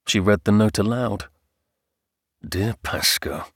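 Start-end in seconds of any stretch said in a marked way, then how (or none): 2.5-3.5 slow, low, soft sardonic drawl. A narrator's reading. Earl mocks the letter's greeting, drawling. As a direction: drawl it through a twisted smile. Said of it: "drawled the Earl with a twisted smile".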